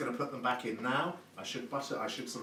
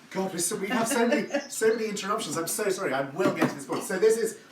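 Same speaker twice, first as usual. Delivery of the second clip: flustered laugh; 7 semitones higher, 6 dB louder than usual. Real, not acted. Flustered by many interruptions.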